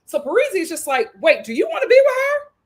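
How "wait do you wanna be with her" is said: high, loud surprised question; a mock startled wait, rising at the end; high-pitched hammed-up confusion; confused demand. Parisia confronting Brian, acted.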